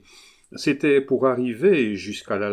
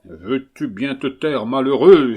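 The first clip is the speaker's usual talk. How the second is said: much louder on malheureux, stamping anger; furious cry; 3 semitones higher, 9 dB louder than usual. A narrator's reading. Collard silences Adolphe, shouting furiously. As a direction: shout the boy down. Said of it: "s'écriait monsieur Collard en frappant du pied".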